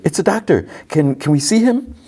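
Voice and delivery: high excited squeal of hope. Retold or imitated. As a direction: bounce with eager hope. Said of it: "She gets excited".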